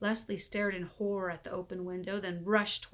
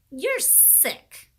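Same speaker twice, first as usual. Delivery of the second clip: exclaiming; 7 semitones higher, 6 dB louder than usual. A narrator's reading. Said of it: "exclaimed Leslie".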